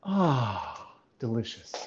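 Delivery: soft, savoring, fading delicious; cooking show voice. Shown as a demonstration. Coach imitating a cooking video host admiring gnocchi. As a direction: relish the dish quietly. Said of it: "a cooking video showing your final plated".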